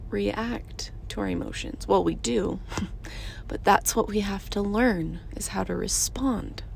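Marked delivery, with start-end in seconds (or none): 0.1-1.9 half-whispered aside. Real, not acted. Confiding to viewers that we don't react, whispered. 5.3-6.6 half-whispered aside. Real, not acted. Confiding to viewers that we don't react, whispered. Lesson on responding.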